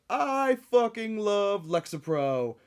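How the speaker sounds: high, loud, slow gushing fan voice; overjoyed online reviewer, hammed up. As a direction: ecstatic fan raving about pills, playful mockery. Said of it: "one in three will just be like".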